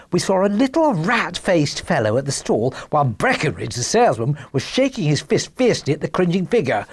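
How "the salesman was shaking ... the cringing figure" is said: plain reading voice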